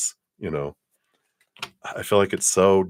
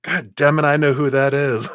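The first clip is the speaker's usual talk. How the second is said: raised, pitched-up exasperated whisper-burst; mock exasperated outburst; 7 semitones higher, 6 dB louder than usual. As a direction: blurt it in playful mock frustration. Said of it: "or you're like".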